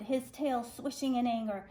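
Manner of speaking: ordinary delivery, nothing marked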